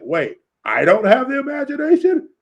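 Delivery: high, rising indignant yell; retold scream. Comic fan retells hero yelling back at villain, mock screaming. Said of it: "he's just screaming".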